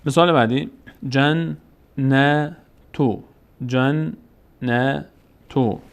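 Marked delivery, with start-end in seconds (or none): 1.1-5.8 جنتو read very slowly with the doubled ن and the long a held; slow, chanted جنتو; slow recitation. Shown as a demonstration. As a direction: stretch جنتو out for the class. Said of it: "صدای آ، جنتو".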